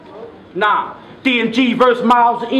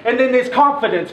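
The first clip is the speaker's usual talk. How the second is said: ordinary talk, nothing marked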